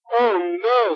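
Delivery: mock despair; dismayed cry. Brother sees crashed bike.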